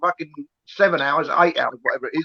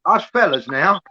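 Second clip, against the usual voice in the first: usual speaking voice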